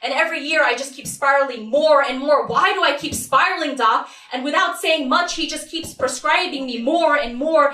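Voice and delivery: high, loud, relentless outpouring; frantic spiral. Acted. Acted spiral into addiction and panic.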